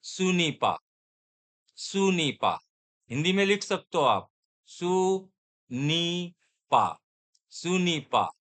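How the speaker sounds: सुनीपा with an o-like first vowel, drawn out; सुनीपा, a teacher's drill word; French ce n'est pas. Shown as a demonstration. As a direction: model सुनीपा slowly for the class. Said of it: "ओ sound है सुनीपा".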